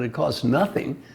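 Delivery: usual speaking voice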